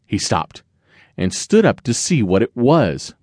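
usual speaking voice

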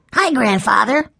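put-on high-pitched child's greeting; eager little boy greeting his grandfather; cheerful young boy. A narrator's reading.

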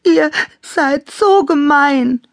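high, loud tearful wail; hurt, high-pitched crying complaint; sobbing. A narrator's reading. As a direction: sob it out in a small hurt voice. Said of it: "schluchzte Klaus Teddy".

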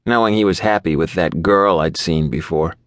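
ordinary delivery, nothing marked

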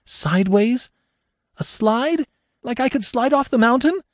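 very high, alarmed questions; rising panic. Acted. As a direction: panic building with each question. Woman panicking at danger.

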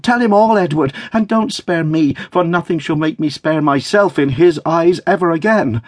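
high, impassioned plea; urgent cry. A narrator's reading. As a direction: beg for the whole truth, high and urgent. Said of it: "cried Dot".

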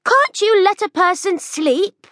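put-on sleepy girl scolding the noise; cross girl's call. A narrator's reading. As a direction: complain from above, annoyed. Girl complaining.

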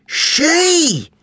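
put-on girl correcting the policeman, high and indignant; indignant rise. A narrator's reading. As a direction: shout the one word in outrage. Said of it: "Eddie's voice rises by at least three decibels".

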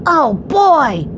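put-on high, gleeful little-boy cheer; excited boy. Benny bouncing in his seat, excited. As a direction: burst out as a thrilled young boy. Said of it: "Benny bounced in his seat".

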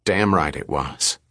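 ordinary delivery

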